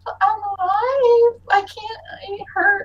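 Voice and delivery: put-on high, whimpering child in pain; hurt child. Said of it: "But Saya is just like".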